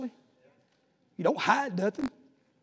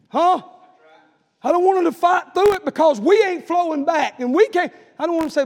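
much higher, louder preaching; preaching at full force; 9 semitones higher, 11 dB louder than usual. Acted. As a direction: drive it home to the congregation.

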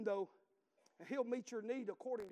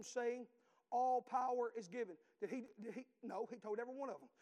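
preaching at full force; 3 semitones higher, 5 dB louder than usual. Acted. Preacher thundering the Great Commission at the congregation. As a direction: preach it out like a revival.

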